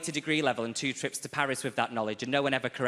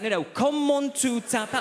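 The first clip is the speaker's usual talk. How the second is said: professor shouting the question, much higher and louder; exasperated shout; 10 semitones higher, 4 dB louder than usual. Retold or imitated. Professor losing patience with the student, shouting. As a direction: bellow the question at the student who won't get it. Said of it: "He's like".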